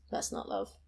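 unvoiced bitter whisper. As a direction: mutter it under your breath. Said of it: "And Luke goes".

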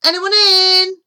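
high, loud questioning call. A narrator's reading. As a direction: call through the door to anyone inside. Calling into a hut.

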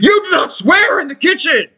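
very high, scolding mock shout; imitated yelling. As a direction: mimic an outraged kid telling someone off. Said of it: "this kid's yelling at me".

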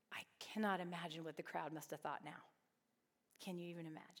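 breathy hushed whisper to the room; whispered aside. Real, not acted. Teacher whispering her wonder to the class. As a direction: lean in and whisper it like a secret.

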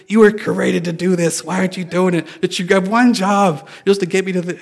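mock complaint at a useless car; joking exasperation. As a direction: scold the machine, half joking. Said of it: "to joke like that".

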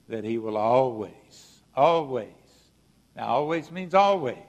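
"always" repeated, each one set apart and punched; emphatic always. Real, not acted. Preacher assuring the congregation of God's promise, hammering "always". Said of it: "I'm gonna emphasize that word".

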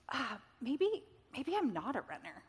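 soft, halting voice of mild self-doubt. Retold or imitated. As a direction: sigh into it, then admit it hesitantly. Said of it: "I just began to have this self-doubt".

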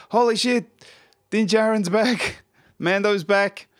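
high thrilled fan exclamation, laughing at the end; excited. Retold or imitated. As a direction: squeal it like a giddy fan. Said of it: "to now we're".

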